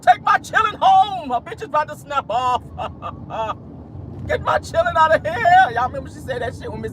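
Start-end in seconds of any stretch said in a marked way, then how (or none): 0.0-2.6 high, hammed-up movie-character holler; Miss Sophia. Mother ordering her children taken away, hammed-up shouting. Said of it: "Miss Sophia, I'm color purple". 2.7-4.2 quiet, breathy cackle; laugh. Real, not acted. Host laughing at own movie impression, breathy cackle. 4.3-5.7 high, hammed-up movie-character holler; Miss Sophia. Mother ordering her children taken away, hammed-up high holler. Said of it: "Miss Sophia, I'm color purple".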